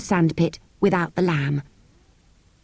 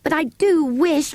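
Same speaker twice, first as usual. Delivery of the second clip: put-on high, slow, wishful girl; 10 semitones higher, slower than usual. A narrator's reading. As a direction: dwell on the wish.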